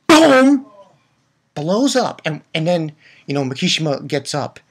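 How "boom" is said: loud, high booming outburst; imitated explosion. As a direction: boom out like a bomb going off.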